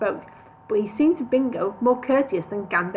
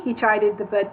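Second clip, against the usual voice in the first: plain reading voice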